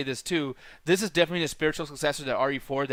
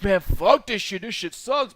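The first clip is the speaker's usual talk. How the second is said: grumpy critic; 6 semitones higher, 5 dB louder, slower than usual. Retold or imitated. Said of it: "those two movies were like".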